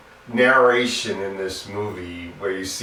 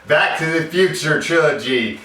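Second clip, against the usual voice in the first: high, loud mock French accent; 6 semitones higher, 4 dB louder than usual. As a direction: announce it in a hammed-up French accent. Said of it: "in this French accent".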